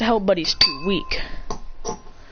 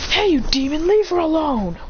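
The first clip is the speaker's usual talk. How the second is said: raised, high-pitched challenge; angry calling out; 7 semitones higher than usual. Acted. Villager defending her from the demon, angry. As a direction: call him off, pitch jumping up.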